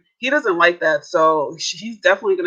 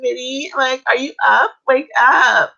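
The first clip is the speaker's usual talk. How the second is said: mock little baby voice, higher; imitating a cutesy girlfriend voice, pitched up; 6 semitones higher than usual. Co-host mocking a wife's sweet wake-up call, baby voice. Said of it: "she talks in that little baby voice".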